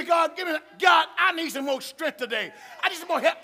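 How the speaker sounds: a hint of a loud, begging prayer; pleading prayer. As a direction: call on God for strength. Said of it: "You say".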